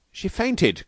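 high-pitched, understated cry. A narrator's reading. Husband tells the doctor of the faint, crying out. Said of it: "cried Kirk".

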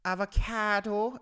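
high, exaggerated Limerick accent; drawn-out Limerick accent. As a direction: say it in a mock Limerick lilt. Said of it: "saying it in this fucking Limerick accent".

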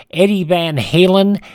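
normal talking voice

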